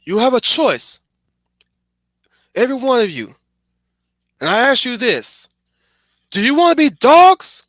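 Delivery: high, loud, rallying battle cry; rousing speech. Retold or imitated. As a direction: shout it to an army before the fight. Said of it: "the line at the end of this speech".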